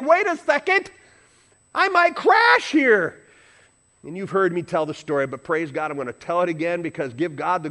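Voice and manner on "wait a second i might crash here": high, loud cry of sudden alarm; mock alarm. Preacher imitating someone too far out on the limb, alarmed. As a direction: play up the panic, stretching the last words.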